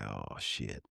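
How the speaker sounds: dropped to a near whisper; scared hush to yourself; hushed oh shit. Retold or imitated. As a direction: mutter it under your breath in alarm. Scared on the train.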